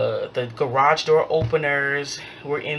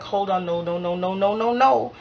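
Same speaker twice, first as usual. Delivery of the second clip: rapid string of no's climbing higher; retold objection; 5 semitones higher than usual. Renter objecting to a demand for more money, rising protest. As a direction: cut them off with rising no's.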